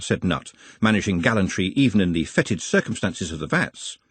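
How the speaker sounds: plain reading voice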